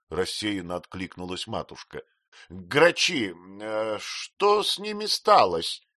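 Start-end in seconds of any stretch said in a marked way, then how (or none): 2.5-5.8 aunt Betsey questioning. A narrator's reading. Aunt interrogates the widowed mother, slow and pointed. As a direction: press her for an answer. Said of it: "допрашивала мисс Бетси".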